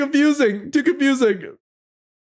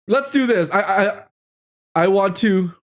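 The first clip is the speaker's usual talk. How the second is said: ordinary delivery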